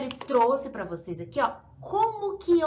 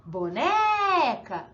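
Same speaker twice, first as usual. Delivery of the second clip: boneca slowed on a long, raised NE; stronger stressed syllable; 4 dB louder, much slower than usual. Shown as a demonstration. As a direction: stretch the middle syllable of "boneca". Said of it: "dizendo de sílaba mais forte".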